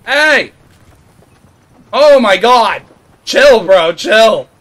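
loud frantic shouts; yelling. Real, not acted. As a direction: shout at him to stop. Yelling at enemy player.

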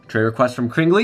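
normal talking voice

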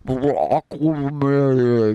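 slow, drawn-out bubbly underwater voice. Pretend. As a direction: talk as if speaking under the sea. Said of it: "that's my underwater voice".

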